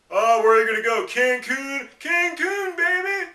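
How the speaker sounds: put-on very high, loud whoop on Cancun baby; mock party excitement. Imitating people assuming he was off to Cancun, in a very high, excited mock whoop. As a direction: play a giddy spring-breaker. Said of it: "when I tell them".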